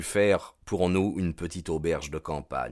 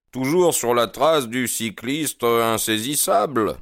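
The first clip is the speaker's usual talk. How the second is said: bright, higher and louder teasing question; cheerful; 6 semitones higher, 4 dB louder than usual. A narrator's reading. Holmes teasing the inspectors over the cyclist, cheerful and higher. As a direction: cheerfully needle the officers about their elusive cyclist. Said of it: "leur demanda gaiement Holmes".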